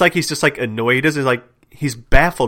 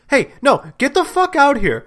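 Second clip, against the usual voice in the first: high-pitched telling-off, acted out; imitated scolding yell; 13 semitones higher than usual. Imitating Avery at badly parked delivery bikes, scolding yell. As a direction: scold like an exasperated parent. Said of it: "like, yelling at".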